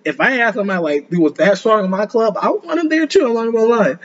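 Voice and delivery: getting hyped about recruiting him; excited. Real, not acted. Enthused aside about wanting him.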